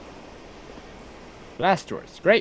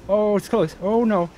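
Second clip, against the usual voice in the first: ordinary talk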